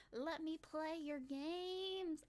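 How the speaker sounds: slow pleading. Real, not acted. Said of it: "I wanna play their games".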